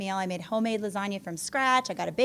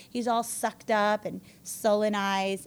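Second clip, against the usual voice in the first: usual speaking voice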